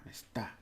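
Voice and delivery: "Está" short, jabbed on "tá"; stress on final syllable. Shown as a demonstration. Teacher repeating it is, stress on final syllable. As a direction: stab softly at the end of "Está". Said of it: "like a little stabbing at the end".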